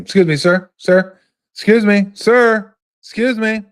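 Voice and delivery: high, loud polite call after someone walking away; imitating a gate agent chasing a passenger; flight attendant calling.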